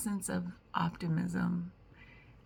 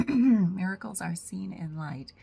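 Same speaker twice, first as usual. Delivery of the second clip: louder, firm full-voiced read; 8 dB louder than usual. Shown as a demonstration. Second louder read of the lesson title to herself. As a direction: clear the throat and say it out.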